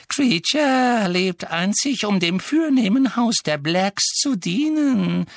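high, loud, drawn-out creature voice; servile elf. A narrator's reading. Elf professing service to the noble house, servile. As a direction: proclaim devotion in a stretched whine.

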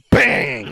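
loud punchy bang; mock punch noise; punch sound. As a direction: smack the word out like the hit. Retelling punching him.

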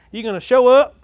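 loud, high-pitched revival preacher; full-force preaching. Real, not acted. Pastor to church about everyone showing up, full-force preaching. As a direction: preach it out at the top of your voice.

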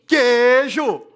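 punchline shout. Real, not acted. Punchline joke about Minas cheese.

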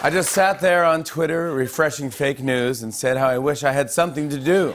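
lively sing-song rhyme recital; Seuss-style rhyme reading. Acted. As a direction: recite it like a children's book. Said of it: "inspired by Doctor Seuss".

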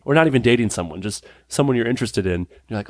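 normal talking voice

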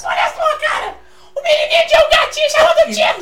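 high, loud squealing cartoon voice; Super Histérico impression. Shown as a demonstration. Drunk [name] doing Super Histérico for the audience, high shrieking voice. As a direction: shriek it like a hyper cartoon character. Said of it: "Deixa eu imitar".

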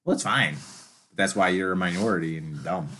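usual speaking voice

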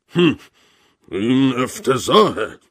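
loud, high, drawn-out indignation; outraged. A narrator's reading. General denounces the unknown host, outraged. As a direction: fume slowly and loudly, pitch swooping up.

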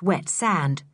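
plain reading voice

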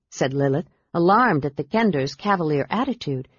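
usual speaking voice

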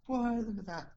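high, thrilled exclamation pointing skyward; imitating an excited stranger; excited onlooker. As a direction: call it out like a wide-eyed bystander. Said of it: "like, excited".

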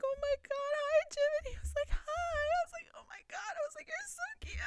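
very high, cutesy squeal; gushing fan. Retold or imitated. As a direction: reenact cooing at an adorable idol, way up high. Retold gushing exchange with idol.

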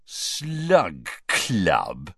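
mocking sneer on Slug Club. A narrator's reading. Ron mocking Hermione's club name with a sneer. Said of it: "repeated Ron with a sneer".

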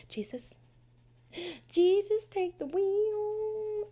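high, slow, stretched cry to heaven; melodramatic plea. Pretend. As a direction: ham up a desperate prayer. Mock plea to Jesus.

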